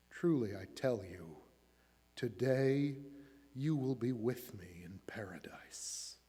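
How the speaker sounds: soft, low, near whisper by the end; hushed reassurance, slowing as it fades; gentle promise. A narrator's reading. Jesus comforts the dying criminal, gently hushed.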